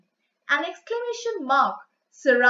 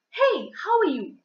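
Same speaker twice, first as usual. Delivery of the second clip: higher-pitched, mildly excited hello; excited greeting; 4 semitones higher than usual. Shown as a demonstration. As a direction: greet someone you are excited to meet. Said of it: "you are very excited to meet someone".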